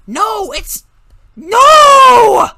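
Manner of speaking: very loud, high frustrated no; loud high angry boy; angry shout. A narrator's reading. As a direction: explode in frustration. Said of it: "Kevin's anger".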